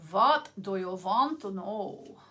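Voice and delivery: put-on slow playful German accent, w turned to v; mock German accent asking the question; fun German accent. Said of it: "a fun German accent".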